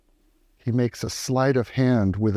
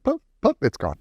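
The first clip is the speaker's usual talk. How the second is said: 1 Jack Sparrow. Retold or imitated. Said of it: "that cool Jack Sparrow thing".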